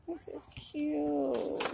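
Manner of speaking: high, soft, drawn-out whispery coo; touched whisper. Real, not acted. Reading farewell cards on camera, touched whisper. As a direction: whisper it slowly, melting over it.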